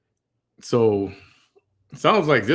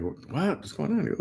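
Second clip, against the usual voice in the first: high, soft startled questions; retold parent's alarm, pitched up and quieter; concerned questioning; 8 semitones higher, 5 dB quieter, slower than usual. Parent asking crying daughter what happened, concerned high questioning.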